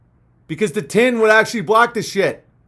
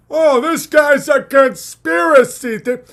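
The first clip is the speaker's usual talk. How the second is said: higher, louder slow sneer; mock-shout at the conspiracy guy; mocking critic shout; 6 semitones higher, 4 dB louder, slower than usual.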